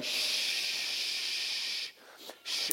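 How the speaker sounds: very long, forceful "shh", then another; insistent shushing. Retold or imitated. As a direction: shush hard while rocking a baby. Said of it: "So I'm rocking him, going".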